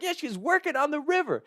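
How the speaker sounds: high-pitched incredulous comeback; retold indignant retort. Tackler answers angry dock girls, high indignant retort. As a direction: throw it back at them, pitch way up.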